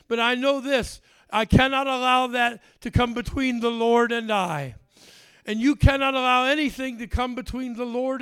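loud, fervent preacher's cry; preaching at full force. Acted. Preacher urging the congregation, loud and fervent. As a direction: drive each line home to the church.

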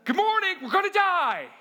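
loud, high mock-panicked wake-up call; panicked yell. Disciples yelling Jesus awake, sure they will drown. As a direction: yell it like a terrified alarm clock.

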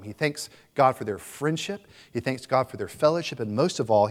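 ordinary delivery

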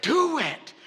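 high, loud shout of do it; shouted answer. Real, not acted. Preacher answering his own question, shouting. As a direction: shout it out in triumph. Congregation call and response.

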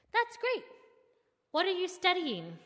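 friendly driver's enthusiastic, curious voice; genuinely curious. A narrator's reading. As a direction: ask with bright, eager curiosity. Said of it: "sounding genuinely curious".

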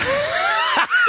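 real laughter cracking up at the joke; burst of laughter. Real, not acted. Crowd joke about bacon.

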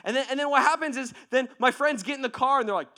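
ordinary talk

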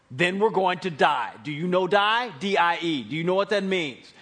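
loud, hammered emphasis on "die"; exasperated, every word punched loudly; pointed inflection. Shown as a demonstration. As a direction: spell out "die" as if to someone dense. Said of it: "That's the voice inflection".

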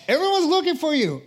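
quick, high, loud exclamation; exclaiming. Acted. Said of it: "they exclaimed".

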